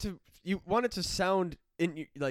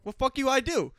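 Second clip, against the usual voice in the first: defiant retort; 9 semitones higher, 7 dB louder than usual. Retold or imitated. Retort to teacher, retold.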